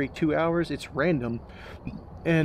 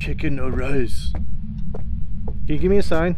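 slow, louder, drawn-out call to the spirit; solemn summoning; 7 dB louder, slower than usual. Pretend. Streamer calling on the ghost for a sign, slow and solemn. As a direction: summon the ghost like a seance leader.

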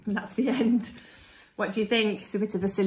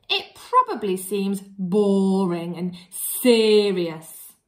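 slow, deliberate storybook read-aloud; reading to children; 4 dB louder, slower than usual. Acted. Teacher reading to her class, slow storybook voice. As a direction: build it up for the class slowly.

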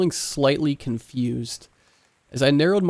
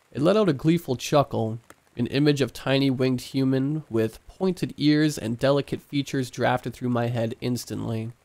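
plain reading voice

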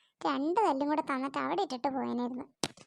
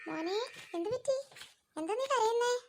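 higher, alarmed mother; a mother rushing in, anxious; worried mother; 7 semitones higher than usual. Acted. Mother to crying son, alarmed and higher.

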